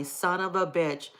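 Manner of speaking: ordinary talk, nothing marked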